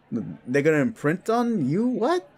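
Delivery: rising high into disbelief; incredulous. Acted. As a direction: climb in pitch to a startled question. Incredulous at imprinting claim.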